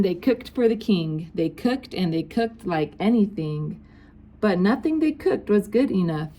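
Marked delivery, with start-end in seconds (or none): none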